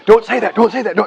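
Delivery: high, loud, hurried hush-up; urgent warning. Retold or imitated. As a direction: stop him from saying the word, high and loud. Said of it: "I got a quick".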